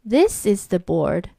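ordinary delivery, nothing marked

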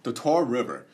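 announcer voice; mock ad read; infomercial.

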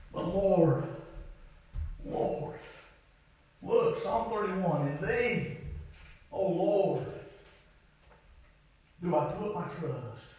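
very soft, low plea; hushed prayer. Retold or imitated. Preacher praying David's psalm to the Lord, hushed. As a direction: let the prayer sink almost to nothing.

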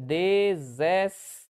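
DEZESSETE drawn out slowly, each syllable separate; very slow, syllable-split "Dezessete". Shown as a demonstration. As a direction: count out the syllables of Dezessete, de-zes-se-te, with gaps. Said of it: "porque quantas sílabas tem?".

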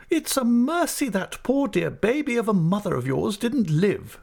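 stern aunt. Acted. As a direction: play the decisive old aunt. Aunt speaks, voiced high.

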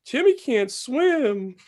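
put-on high, slow child's voice; little boy voice. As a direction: sadly say it like a small boy. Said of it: "the little boy says".

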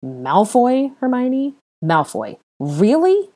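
high, loud incredulous questioning; scandalized, insistent protest; revolted disbelief. A narrator's reading. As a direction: soar upward in appalled disbelief. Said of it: "insisted in a revolted disbelief".

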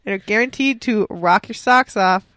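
slow, deliberate radio promo; slow ad read. Acted. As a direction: read the promo slowly and carefully. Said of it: "while I'm reading an ad".

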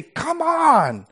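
high, slow, pleading cry; exasperated plea. Shown as a demonstration. God pleading with his people, exasperated and high. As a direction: shake them awake with the words. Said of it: "grab you and shake you and say".

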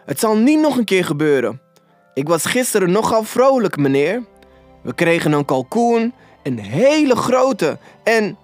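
high, anxious pleading. A narrator's reading. Robert excuses himself to Scrooge, high and pleading. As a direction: keep begging, voice pitched high. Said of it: "smeekte Robert".

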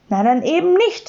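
high, huffy retort; offended. A narrator's reading. Sams reacts to being forbidden to eat things, offended. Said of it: "sagte das Sams beleidigt".

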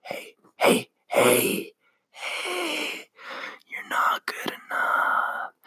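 breathy, creeping demon whisper in the ear; slow, unvoiced whisper of a taunting inner demon. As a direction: hiss it like a little demon at the back of the head. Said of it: "this little demon starts creeping up in the back of your head and starts going".

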